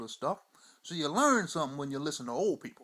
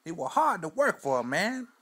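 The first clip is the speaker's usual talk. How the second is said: put-on old Mudbone; 3 semitones higher, 4 dB louder than usual. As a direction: spin the yarn like a weathered old man on the porch. Old storyteller character throughout.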